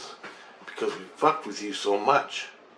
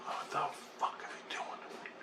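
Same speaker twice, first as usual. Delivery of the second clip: 1 baffled mutter; 13 dB quieter, slower than usual. Retold or imitated.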